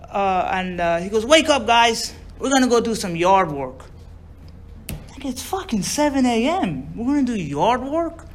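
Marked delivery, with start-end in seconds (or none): 1.3-3.8 loud, higher uncle's call; brisk mock reveille; rousing wake-up call. Uncle rousing hungover boys. 5.1-8.3 high, drawn-out disbelief; high retold whine of protest; incredulous protest. Boys protest early yard work.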